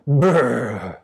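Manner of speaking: long shivery "brrr"; chilly, falling brrr sound; shivering brrr. A narrator's reading. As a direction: shudder out a drawn-out brrr with cold. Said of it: "They just said".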